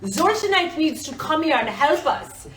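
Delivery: high, loud pleading villagers; villagers calling for help. Pretend. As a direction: beg the hero to come save you. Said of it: "the village is like".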